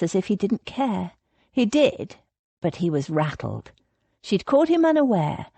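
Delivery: ordinary delivery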